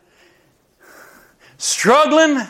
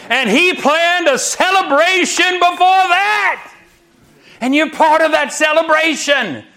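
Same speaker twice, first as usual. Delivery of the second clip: high, rousing preacher's call; preaching; 7 semitones higher than usual. Acted. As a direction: lift the room with each line. Preaching at full force, higher.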